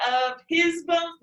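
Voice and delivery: of HIS bones, middle word high and slow; trial stress on HIS. Shown as a demonstration. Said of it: "No, I'd say".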